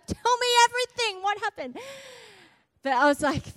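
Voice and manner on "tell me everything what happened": high, eager burst of questions; fast, higher-pitched excited demand; bursting curiosity. Acted. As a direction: blurt it out, desperate for every detail. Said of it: "I wanna be like".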